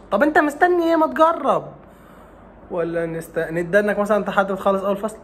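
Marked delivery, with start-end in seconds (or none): 0.1-1.7 much higher and louder, urging; exasperated push. Acted. Teacher urging the student to finally try, exasperated. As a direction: come on, just try it!.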